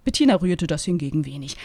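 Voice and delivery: usual speaking voice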